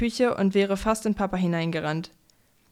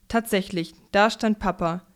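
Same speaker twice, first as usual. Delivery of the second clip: usual speaking voice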